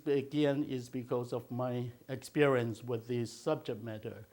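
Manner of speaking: ordinary talk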